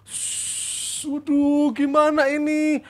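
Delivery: hiss, then fret in a high voice; acted cold-sweat worry.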